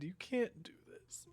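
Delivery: breathy pleading whisper; hushed plea. Real, not acted. Pleading with the book.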